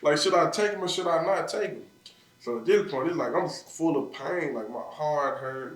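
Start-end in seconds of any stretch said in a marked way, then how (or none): 0.0-1.8 higher, louder anguished retelling; retold crying. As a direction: relive a tearful dilemma, pitch raised. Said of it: "I'm c-, crying".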